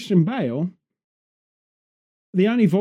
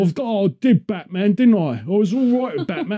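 Guy Ritchie Cockney; 4 semitones higher than usual. Retold or imitated. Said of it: "sounds like a goddamn Guy Ritchie".

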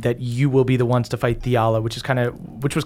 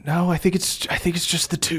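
a touch higher-pitched character voice; lightly voiced Erdan; 5 semitones higher, 3 dB quieter than usual. Erdan answering about the three hearts, higher and hesitant.

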